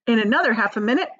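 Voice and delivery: usual speaking voice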